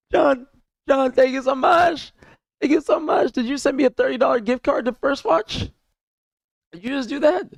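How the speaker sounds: high, loud squeal of gratitude; very high, emotional thanks; overwhelmed excitement. Real, not acted. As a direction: squeal it out, overcome by a gift. Overwhelmed by surprise gift.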